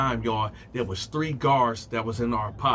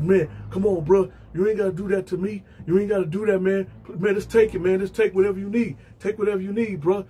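scared begging; 5 semitones higher, faster than usual. Retold or imitated. Victim begging in retold fight.

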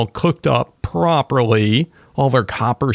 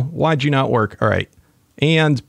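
ordinary talk

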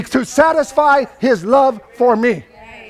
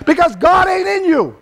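loud, shouting preacher at the peak; full-force preaching; 5 semitones higher, 4 dB louder than usual. Acted. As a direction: thunder it from the pulpit. Preaching climax.

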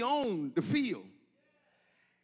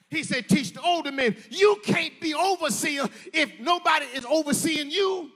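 higher, louder whooping sermon cadence; preaching at full force; 7 semitones higher, 6 dB louder than usual. Acted. Preacher urging the congregation, shouting louder and higher. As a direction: build and hammer each line home.

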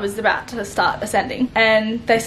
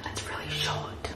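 hushed, voiceless whisper; very soft whispered confession; 15 dB quieter than usual. Real, not acted. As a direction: whisper it as a quick aside. Whispered aside about the dress.

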